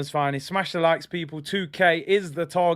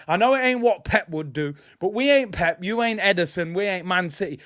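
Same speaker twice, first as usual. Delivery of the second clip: normal talking voice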